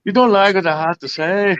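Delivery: light Irish leprechaun lilt; leprechaun accent. Acted. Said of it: "A leprechaun accent".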